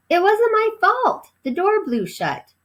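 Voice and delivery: high, protesting mouse voice; squeaky mouse insisting it's not his fault; defensive little mouse. Acted. Ralph protests to Keith.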